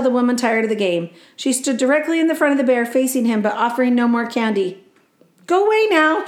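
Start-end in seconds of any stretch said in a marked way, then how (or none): 5.5-6.2 high, sing-song mock order. The woman orders the bear away in a high mock command. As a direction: shoo the bear away, teasingly. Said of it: "she ordered".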